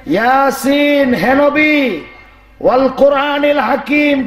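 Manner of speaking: high preaching declamation, not chanted; declaimed explanation. Shown as a demonstration. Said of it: "কি শুনাইলেন?".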